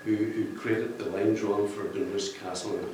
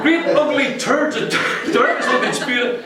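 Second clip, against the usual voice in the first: high, loud, laughing through Great ugly turds of darkness; laughing reread; 14 semitones higher, 8 dB louder than usual. Real, not acted. Rereading the author's phrase to the crowd, high and laughing. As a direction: repeat the phrase while cracking up. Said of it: "I'm only joking".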